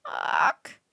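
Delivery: a tiny bird's hoarse cry; hoarse croak. Acted. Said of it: "came a hoarse cry".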